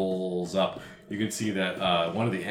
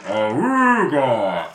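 long, loud "aruga" honk; loud drawn-out horn imitation; imitated horn noise; 5 semitones higher, 8 dB louder, much slower than usual. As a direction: blare it out like an old klaxon. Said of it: "It goes".

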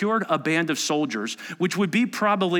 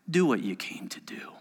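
whisper; 4 semitones lower, 5 dB quieter than usual. Acted. Jesus quietly answers his betrayer.

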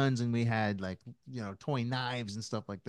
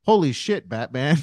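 son's catchphrase; 4 semitones higher, 11 dB louder than usual. Retold or imitated. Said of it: "the catchphrase is".

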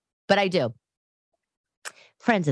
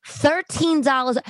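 incredulous repeat; 9 semitones higher, slower than usual. Acted. Repeating shocking price.

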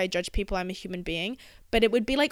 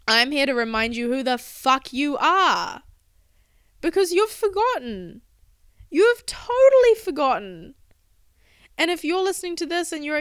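passion building until it is almost a shout, louder and higher; impassioned outburst; 6 semitones higher, 5 dB louder than usual. Real, not acted. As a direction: drive it home harder with each line. Impassioned call to listeners.

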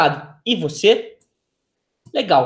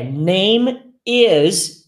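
slowed full form. Shown as a demonstration. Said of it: "por que que tem name apóstrofo S".

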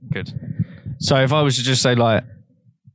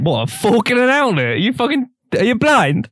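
high, disbelieving outburst; as if she can't believe her ears; incredulous outrage; 12 semitones higher than usual. Real, not acted. Mock outrage at friend.

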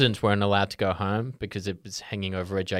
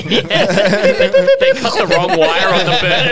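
laughing; 9 semitones higher, 7 dB louder than usual. Real, not acted. Laughing joke about exploding bird.

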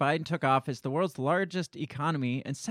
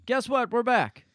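gleeful mock announcement; 9 semitones higher, 4 dB louder than usual. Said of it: "he says".

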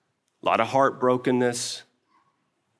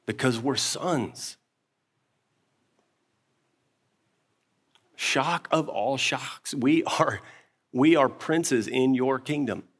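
pastor's prayer. Acted. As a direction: pray earnestly over the congregation. Pastor leads closing prayer.

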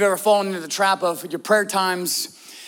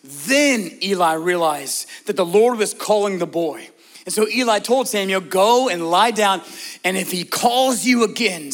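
normal talking voice

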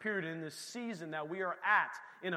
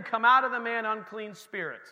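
loud, firm command, much louder; 4 semitones higher, 9 dB louder than usual. Retold or imitated. Jesus casting out the unclean spirit, loudly commanding. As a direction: order the spirit out with full authority.

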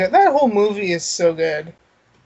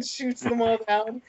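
ordinary talk

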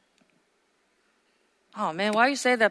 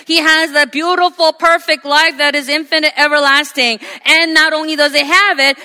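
loud, ringing sermon delivery; preaching at full force; 4 semitones higher, 7 dB louder than usual. Acted. Pastor preaching to his congregation, loud and ringing. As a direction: preach it out to the whole room.

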